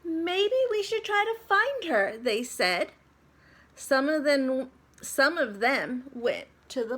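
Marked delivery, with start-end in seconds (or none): 0.0-2.2 put-on much higher child voice; kids. A narrator's reading. The kids in room two oh seven, worried, in a high child voice.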